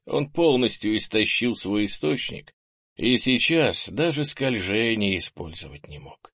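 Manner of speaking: ordinary delivery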